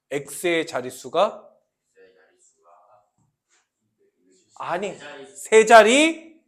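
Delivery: slow teacher's read-aloud of a math expression; slow demonstrative reading. Shown as a demonstration. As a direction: read it out slowly, phrase by phrase. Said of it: "이렇게 읽는 거예요".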